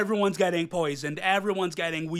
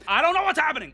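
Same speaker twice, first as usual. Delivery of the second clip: higher, louder bewildered outburst; sudden exclaim; 10 semitones higher, 6 dB louder, faster than usual. Real, not acted. Vlogger confused at the end, loud bewildered outburst.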